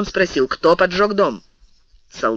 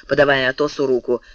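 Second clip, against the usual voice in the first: ordinary delivery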